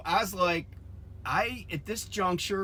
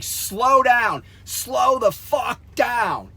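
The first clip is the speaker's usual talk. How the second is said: loud, higher-pitched re-enacted shout; retold scream; 6 semitones higher, 6 dB louder, much slower than usual. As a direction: yell it like warning a reckless driver. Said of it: "I am screaming".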